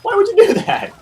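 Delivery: high, loud incredulous protest; mock appalled. Co-host reacts to quest offering dog stew, mock-appalled high cry.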